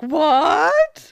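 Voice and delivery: loud, high, rising fake astonishment; hammed-up gasping disbelief; mock shock. Host mocking the author's late realization, exaggerated shock. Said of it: "and she's like".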